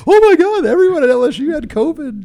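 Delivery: loud, very high exaggerated alarm; mock outcry. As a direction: imitate everyone shrieking the big news. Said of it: "everyone's like".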